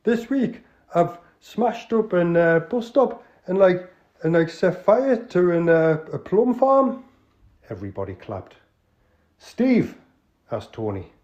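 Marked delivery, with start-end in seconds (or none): 0.0-7.0 slow, high, loud Geordie boast; Geordie accent. Acted. Clive confessing to the club, slow Geordie. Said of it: "an authentic, uh, Northerner". 9.4-10.0 loud Geordie call to order; Geordie accent. Acted. Tony calling the crooks to order, Geordie. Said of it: "an authentic, uh, Northerner".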